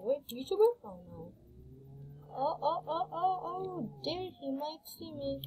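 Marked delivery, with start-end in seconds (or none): 0.8-1.3 whisper. Real, not acted. Hiding so a player won't see. 2.3-5.4 hushed alarm. Real, not acted. Hiding so a player won't see.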